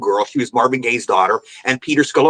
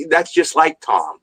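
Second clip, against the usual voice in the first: slow, knowing, lightly voiced Peter; knowing drawl as Peter; much slower than usual. Peter to Eddie about Tom, slow knowing tone. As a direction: stretch it out, in on the joke. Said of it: "And Peter goes".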